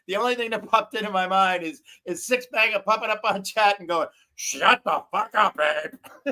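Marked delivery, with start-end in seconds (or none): 4.3-6.3 put-on Northern New England accent. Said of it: "With a Northern New England accent".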